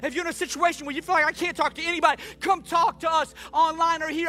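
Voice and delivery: high, loud, fervent sermon voice; full-force preaching. Acted. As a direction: preach it out to the whole room.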